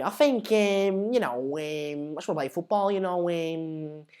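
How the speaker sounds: hesitant Scouse, fillers stretched right out; Wayne Rooney. Acted. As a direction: do a mumbling Scouse post-match interview. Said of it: "that's Wayne Rooney".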